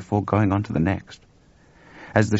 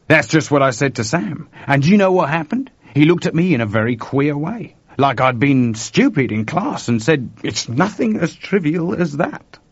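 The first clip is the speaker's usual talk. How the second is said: higher, emphatic character voice; animated Chuck retelling; 7 semitones higher, 5 dB louder than usual. Acted. Chuck tells George how Sam looked at him, animated. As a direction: retell it keenly, stretching the final quote.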